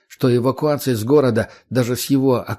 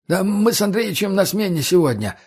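husky, high nervous voice; hoarse from nerves; 8 semitones higher than usual. A narrator's reading. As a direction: rasp it out, rattled. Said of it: "осипшим, походу, от волнения голосом".

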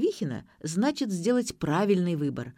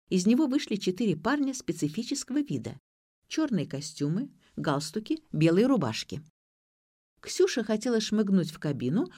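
plain reading voice

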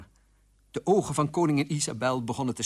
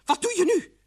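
very high, exasperated demand; lost patience; 4 dB louder than usual. Acted. Queen demands action from the king, exasperated. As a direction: demand it with pitch right up. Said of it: "verloor de koningin haar geduld".

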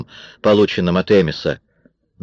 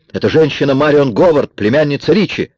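high, raised accusing voice; shouted denunciation; 7 semitones higher, 5 dB louder than usual. A narrator's reading. Kara exposes Marion to the gang, crying out. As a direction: denounce the woman loudly and high. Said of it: "закричала Кара".

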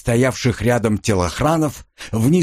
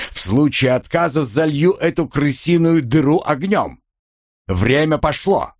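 higher, commanding amplified call; booming amplified commander; 6 semitones higher than usual. Acted. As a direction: order them out like a loudspeaker. Commander calls through amplification.

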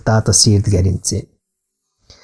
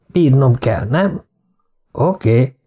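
plain reading voice

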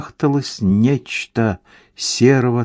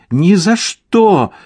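high, slow, drawn-out refusal; defiant echo; 10 semitones higher, 4 dB louder, slower than usual. A narrator's reading. Soaked wife echoing her husband's refusal, defiant echo. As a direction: stretch the refusal out in a high wifely voice. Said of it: "повторила за ним насквозь промокшая жена".

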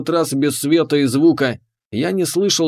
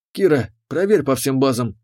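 ordinary delivery

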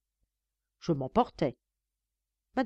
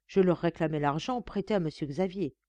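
ordinary delivery, nothing marked

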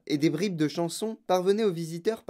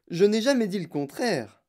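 higher, emphatic denial; monsieur Wonka; 7 semitones higher, 4 dB louder than usual. A narrator's reading. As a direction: deny it in a raised voice. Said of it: "dit monsieur Wonka". (démenti appuyé, voix plus aiguë)

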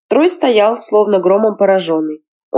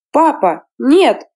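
put-on girl's shout; 7 semitones higher, much slower than usual. A narrator's reading. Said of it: "громко закричала девочка".

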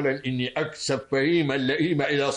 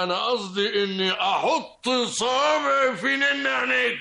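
taunting yell; 8 semitones higher than usual. Acted. Taunting in the quarrel.

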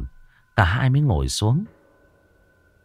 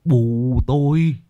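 frightened husband; 6 semitones higher, 3 dB louder, slower than usual. Acted. Terrified husband stammers.

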